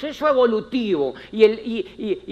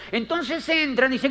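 ordinary talk, nothing marked

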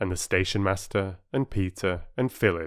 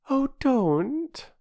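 put-on very high, upset girl's plea; begging the doctor not to smile; pleading girl. Bobby voiced pleading.

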